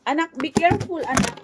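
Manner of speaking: quick call to stop her; sharp warning. Real, not acted. Warning child near knife.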